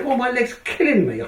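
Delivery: crying in pain. Retold or imitated. Patient waking up to Gloria after treatment, mildly tearful complaint. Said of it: "I'm crying".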